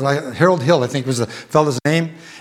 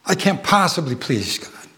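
ordinary talk, nothing marked

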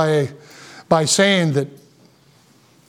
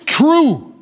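"true" said alone, high and falling; "true" set apart and stressed, pitch raised; emphatic true; 5 semitones higher than usual. Shown as a demonstration. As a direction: land hard on "true" as a word by itself. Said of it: "the emphasis here is on the word".

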